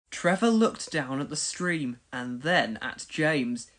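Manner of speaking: ordinary delivery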